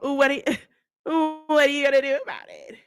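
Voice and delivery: mock her challenge while cracking up; mocking imitation. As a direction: put on her combative talk, giggling. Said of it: "that's how she talk".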